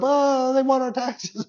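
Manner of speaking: high, fretting voice as Peter; mock panic over the taxes; worried Peter. Pastor voicing Peter in a high fret. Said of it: "When Peter comes up".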